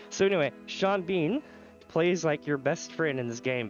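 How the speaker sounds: ordinary talk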